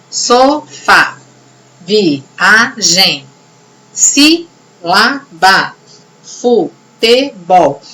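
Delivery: SO-FÁ, VI-A-GEM, SÍ-LA-BA, FU-TE-BOL, each syllable said on its own; separado em sílabas. Shown as a demonstration. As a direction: break "sofá, viagem, sílaba, futebol" into slow, separate syllables. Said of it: "vocês vão separar a sílaba". ("sofá, viagem, sílaba, futebol" dito pausadamente, sílaba por sílaba)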